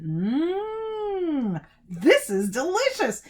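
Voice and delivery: long high mmm, then slow relish; savouring delight. A narrator's reading. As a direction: savour every word with delight. Boy tastes sweet sap.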